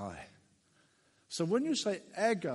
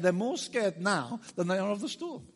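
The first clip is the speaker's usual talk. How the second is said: ordinary delivery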